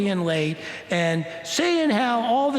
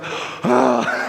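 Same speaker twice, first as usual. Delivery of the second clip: relieved gasp; 5 semitones lower, 5 dB louder than usual. Retold or imitated. Relieved listeners in car.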